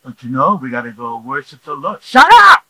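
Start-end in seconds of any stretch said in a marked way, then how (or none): 2.0-2.6 sudden high screaming shout; mock angry shout. Spouse turns from sweet to furious, screamed. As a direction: explode at your spouse.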